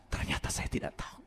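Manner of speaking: hushed whispered confession. Real, not acted. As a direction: breathe it out as a secret admission. Confession said under breath. (berbisik mengaku)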